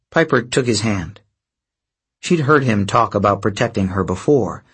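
ordinary delivery

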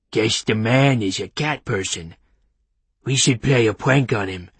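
cartoonish dog voice, plotting a prank; mischievous talking dog. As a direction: speak as a scheming, playful dog. The dog's voice in story.

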